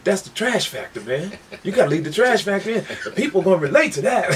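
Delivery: high-pitched, insistent mock protest; George. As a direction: argue excitedly, breaking into a laugh at the end. Acts out George defending trash factor.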